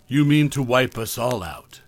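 ordinary delivery

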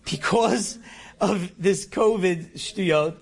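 ordinary delivery

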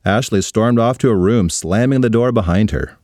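ordinary delivery, nothing marked

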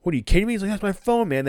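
imitating a furious man whose phone got grabbed; pissed off. Sammy yelling at Max over his recorded phone, angry. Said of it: "Sammy was pissed off".

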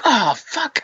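high, soft dismayed "oh, fuck"; dismayed groan. Retold or imitated. Realizing his ally is talking aliens, high soft dismay. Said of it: "I was like".

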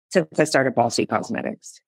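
ordinary talk

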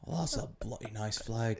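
soft put-on British accent; mock British accent. Complimenting the host's Irish flag, mock British accent. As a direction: play the Brit, quietly. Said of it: "gonna be in a British accent".

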